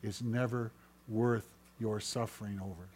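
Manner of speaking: very soft, low-key voice; hushed whisper of God. Acted. Preacher as God whispering to you about suffering, hushed. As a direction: drop right down, confiding each word gently. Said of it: "God whispers to you and says".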